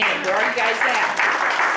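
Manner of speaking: calling to the veterans in the crowd, slow and loud. Real, not acted. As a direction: stretch it out to reach the back rows.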